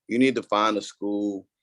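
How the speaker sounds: ordinary talk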